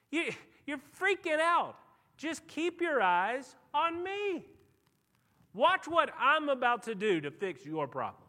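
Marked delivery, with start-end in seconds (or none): none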